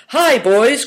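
high, loud, merry greeting; merry call. A narrator's reading. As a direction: call out cheerfully to the boys. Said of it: "cried Good Fortune merrily".

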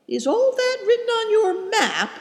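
very high, amazed question; astonished. A narrator's reading. Companion marvelling at what a map holds, astonished. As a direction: ask in wonder, voice leaping high.

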